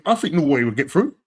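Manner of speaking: ordinary talk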